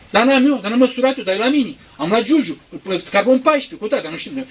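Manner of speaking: high, loud know-it-all; smug skeptic. Acted. Preacher mimics a skeptic bragging about carbon dating. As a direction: brag about the measurement.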